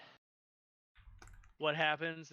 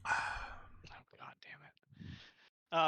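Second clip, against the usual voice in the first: whispering; 7 dB quieter, much slower than usual. Real, not acted. Frustrated aside, unvoiced.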